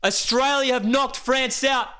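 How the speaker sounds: high, drawn-out shouts of a winning moment; euphoric live commentary. Real, not acted. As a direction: roar out the historic win, stretching key words. Live play-by-play celebration.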